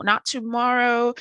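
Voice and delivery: ordinary delivery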